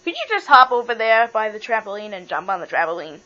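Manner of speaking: ordinary talk, nothing marked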